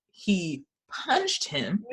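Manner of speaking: very slow, careful "He punched him."; a slow, stilted work voice, half laughing; proper meeting voice. Shown as a demonstration. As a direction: put on a stiff, proper voice for the meeting. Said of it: "in a meeting, so I'm like".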